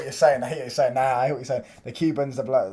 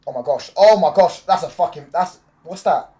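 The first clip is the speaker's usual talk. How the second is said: loud, raised-pitch outburst; startled excitement; 5 semitones higher, 10 dB louder than usual. Real, not acted. Streamer reacting to the match live, loud startled excitement. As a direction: blurt it out in stunned excitement.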